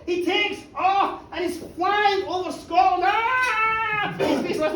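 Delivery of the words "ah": high, held "ah"; hammed-up airborne yell; mock scream. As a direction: scream as if flying out of control. Hamish screams flying over Scotland.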